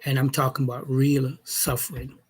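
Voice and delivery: ordinary talk, nothing marked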